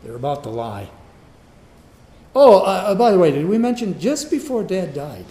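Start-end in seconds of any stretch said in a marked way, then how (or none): 2.3-5.2 louder, higher, stammered fake afterthought; scheming brothers. Brothers spinning a lie to Joseph, mock-casual and loud. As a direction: blurt out a phony afterthought. Voicing brothers inventing a story.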